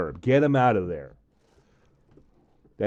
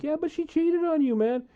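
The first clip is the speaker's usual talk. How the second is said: put-on squeaky high drunk retort; high mocking voice arguing with a friend; mock high squeak. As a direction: pitch it way up, mocking.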